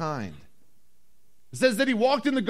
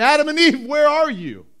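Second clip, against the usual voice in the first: high, loud searching call; acted shout to missing people; 7 semitones higher, 8 dB louder than usual. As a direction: call out for someone hiding far off. Acts God calling for Adam, Eve.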